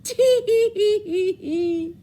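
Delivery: high, slow monkey giggle; teasing monkeys. Acted. Monkeys mocking the peddler with high slow giggles. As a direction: tease him like cheeky monkeys. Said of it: "the monkeys ... said".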